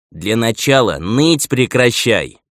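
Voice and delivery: loud, slow, firm order; stern. A narrator's reading. Steward tells the elder to stop whining, stern. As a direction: sternly put an end to whining. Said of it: "строго произнёс Клета".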